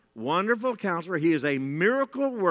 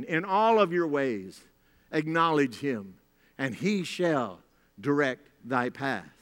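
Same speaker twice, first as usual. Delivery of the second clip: scripture recitation; 4 semitones lower than usual. Real, not acted.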